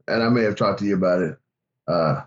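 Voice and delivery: ordinary delivery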